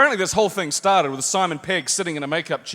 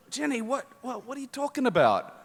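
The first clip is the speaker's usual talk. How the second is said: soft, higher, halting confusion; groggy bafflement; 7 semitones higher, 7 dB quieter than usual. Acted. Actor just woken by his agent's call, softly baffled.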